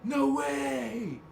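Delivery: excited high surfer dude exclamation; Bill and Ted surfer. Retold or imitated. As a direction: shout it like a stoked surfer dude. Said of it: "Like Bill and Ted?".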